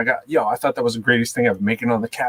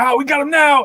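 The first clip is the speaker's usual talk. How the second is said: high-pitched gloating officer; hammed-up mock triumph; imitated triumphant cop; 14 semitones higher, 4 dB louder than usual. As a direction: crow it like a cop who's caught someone. Retold cop triumph at trunk.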